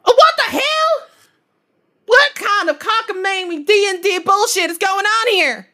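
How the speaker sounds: high, loud startled yell; shocked outburst. Acted. Trucker character startled by lightning from the phone, yelling. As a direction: shout it in sudden disbelief.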